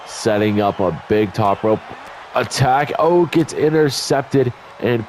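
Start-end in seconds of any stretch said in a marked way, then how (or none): none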